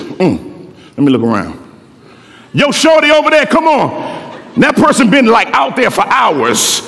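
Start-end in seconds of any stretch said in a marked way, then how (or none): 0.0-1.6 low, laid-back Father voice, lightly voiced; a relaxed low hum before scanning the court; deep casual God voice. 2.5-3.9 put-on higher, easy street call to a kid; calling across court. God calls across the court.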